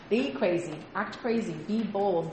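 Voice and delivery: ordinary talk, nothing marked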